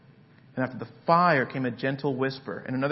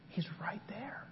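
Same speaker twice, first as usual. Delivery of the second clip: breathy whisper; sermon whisper showing God is close; 6 semitones higher, 13 dB quieter than usual. Shown as a demonstration. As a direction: lean in and whisper it. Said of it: "Why a whisper?".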